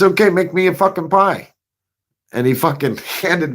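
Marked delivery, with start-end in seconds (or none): 0.0-1.5 higher-pitched daring boss voice; mock boss dare. Boss daring applicant to prove it, mock dare. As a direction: throw down the dare. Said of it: "He says".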